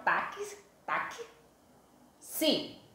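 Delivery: táxi broken into "taque, taque, si", pausing before si; split into sounds. Shown as a demonstration. Teacher showing pupils the sounds inside táxi, split into sounds. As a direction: isolate the hidden K sound in "Taque, taque, si". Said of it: "quando eu pronunciei o fonema, o som que saiu".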